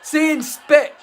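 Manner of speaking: high, loud comic protest; hammed-up outraged self-defence; mock indignation. Mock protest against imagined accusations.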